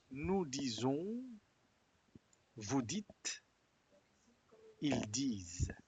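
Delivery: slow, careful "Nous disons", silent final s on "disent"; model pronunciation. Shown as a demonstration. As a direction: say each form clearly, slowly. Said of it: "Très bien, esa es la buena pronunciación". (pronunciación modelo, lenta y clara)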